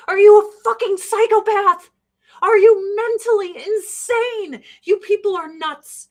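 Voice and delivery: loud, high outraged yell; furious shout. Real, not acted. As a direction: blast the question at him in real disbelief. Ranting at a chatter.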